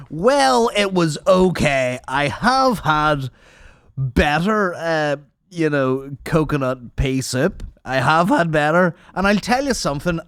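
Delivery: mock food critic. Diner reviewing his meal to the waitress, mock-critical and drawn out. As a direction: draw out each grudging judgement like a self-important critic.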